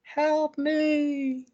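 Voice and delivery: very high, eerie, faraway call for help; a ghostly plea from the distance; the killer's mother imitating a victim, eerie high call; creepy distant voice. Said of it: "you hear the really creepy".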